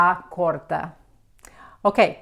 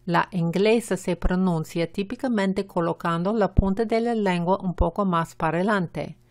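usual speaking voice

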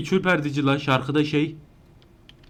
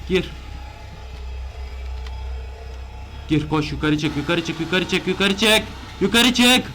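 frantic urging; 6 semitones higher than usual. Real, not acted. Gamer running from the chaser, frantic high shouting. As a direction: hurry yourself up in panic.

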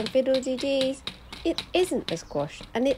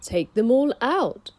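put-on wise old sage; 4 semitones higher, 7 dB louder than usual. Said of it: "said the wise old man".

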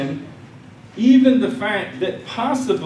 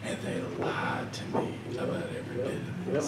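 whispered and much softer; hushed confession; 5 semitones lower, 12 dB quieter than usual. Retold or imitated. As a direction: drop to a whisper as if sharing a secret. Friend confesses seminary lied.

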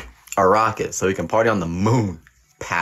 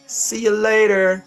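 much higher, slow sing-song goodbye; drawn-out high goodbye; 13 semitones higher, slower than usual. Real, not acted. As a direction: stretch it out high and slow, teasing.

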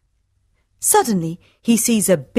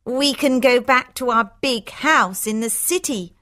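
high, lightly voiced sister; pleased Hortensia; 4 semitones higher than usual. Sister hopes to return to the city, pleased. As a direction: look forward in a high voice.

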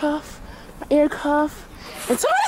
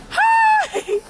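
excited shout; 4 dB louder than usual. Real, not acted. Greeting outside in snow.

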